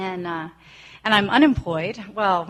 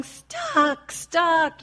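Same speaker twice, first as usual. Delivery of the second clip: high, mock-frantic toddler cry; toddler shouting stuck; 9 semitones higher, 4 dB louder than usual. Mother mimics her toddler stuck in a turtleneck, high mock cry. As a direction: imitate a little girl trapped in her shirt. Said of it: "she's shouting".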